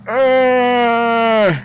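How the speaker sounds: loud strangled groan of pain. Retold or imitated. Brother hits him, he lets out a loud groan. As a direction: groan like you just got hit below the belt.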